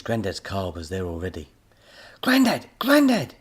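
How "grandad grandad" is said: loud high calls; calling out. A narrator's reading. Boy bursting in calling Grandad, loud and high. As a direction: call him from the doorway. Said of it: "Tommy cried as he burst".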